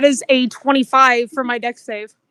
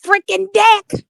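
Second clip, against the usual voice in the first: mild warning yell, raised pitch; acted yell; 7 semitones higher than usual. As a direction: call out a warning, higher than usual. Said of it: "I'm gonna yell".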